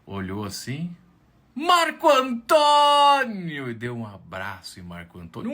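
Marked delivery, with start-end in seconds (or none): 1.5-3.7 high, drawn-out, loud cry of his name; ecstatic greeting. Acted. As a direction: hail him like a hero. Doorman greets him effusively.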